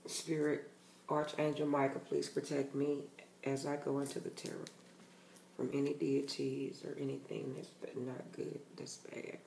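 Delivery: hushed invocation, trailing to almost nothing; prayer. Real, not acted. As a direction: pray quietly to Spirit, fading away. Says a protective prayer before reading.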